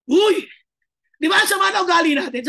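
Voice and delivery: loud, jolting call to attention; preacher's loud wake-up call; sudden shout. Real, not acted. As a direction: startle them awake with a yell. Sudden shout to jolt the congregation.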